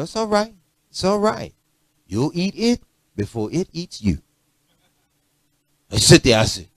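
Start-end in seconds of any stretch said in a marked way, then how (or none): 0.0-1.5 higher, soft motherly coaxing; mother's voice. Retold or imitated. Mother coaxes her child to eat dinner, soft and higher. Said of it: "My mother said". 2.1-4.2 mother's soft warning. Retold or imitated. Mother warns her child about dinner, soft and gentle. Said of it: "My mother said".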